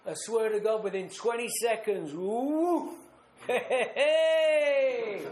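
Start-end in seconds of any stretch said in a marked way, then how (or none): 2.1-5.2 higher, louder gleeful whoops with laughter; whooping. Retold or imitated. Drink hits him, laughing triumphant whoops. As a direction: let the booze rush burst out.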